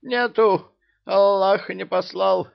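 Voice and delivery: high, whiny pleading voice; high pleading emir. Acted. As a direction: plead up high like a cornered man.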